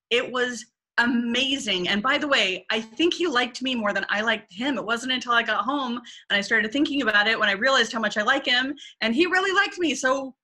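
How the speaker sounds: woman gushing about a date, then pleading why; gushing, insistent woman. Retold or imitated. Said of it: "some women who are like".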